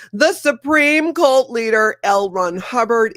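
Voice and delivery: loud, high, drawn-out announcer flourish; mock-grand announcement. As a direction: proclaim the title with mock pomp. Mock-grand title for cult founder.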